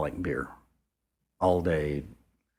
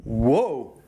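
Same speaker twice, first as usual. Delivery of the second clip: louder, a bit higher mock yelp; startled cry; 6 semitones higher, 5 dB louder than usual. Charlie nearly falling off the cliff, with a mock startled yelp. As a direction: yelp as if losing your balance.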